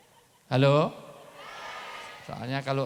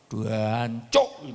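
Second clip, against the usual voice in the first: East Javanese curse; 5 semitones lower than usual. Retold or imitated. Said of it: "Apalagi Jawa Timur sana".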